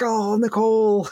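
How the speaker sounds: exasperated at being pranked, playfully; mock exasperation. Mock reproach to prank-calling girlfriend.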